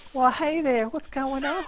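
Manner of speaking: imitating a sweet Southern belle answering the phone. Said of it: "this Southern Belle answers".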